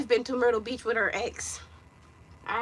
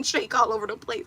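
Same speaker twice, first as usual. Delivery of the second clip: ordinary talk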